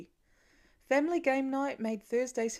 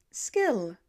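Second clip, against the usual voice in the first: deadpan; 4 dB louder than usual. A narrator's reading. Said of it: "totally deadpan".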